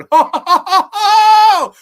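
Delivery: high, loud scornful laugh; loud bitter laugh. Real, not acted. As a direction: burst out laughing in disbelief.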